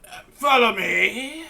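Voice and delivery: high, loud, slow hag voice; hag beckoning. Acted. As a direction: draw them after you. Hag leading guests.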